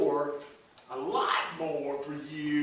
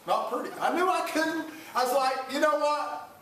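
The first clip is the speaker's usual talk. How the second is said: excited; 9 semitones higher than usual. Retold or imitated. Hunter recalls bracing for shot.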